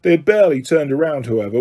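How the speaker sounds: plain reading voice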